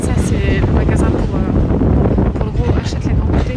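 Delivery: booming shop-announcer push; loud hype. Real, not acted. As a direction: sell it loud. Loud joking shop pitch.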